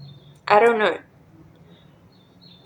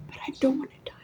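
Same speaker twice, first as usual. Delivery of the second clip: retold desperate prayer in a whisper; whispered plea; 3 semitones higher, 14 dB quieter, much faster than usual. Praying to God after an overdose, whispered plea. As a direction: whisper it, begging to live.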